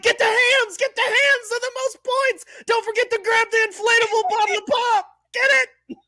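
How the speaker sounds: high-pitched fan yelling. Retold or imitated. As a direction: shout at the TV screen. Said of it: "I'm like".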